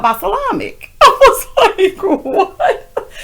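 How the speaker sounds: cracking up mid-word; high giggling what; laughing. Real, not acted. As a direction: laugh through the disbelief. Laughing at mispronunciation.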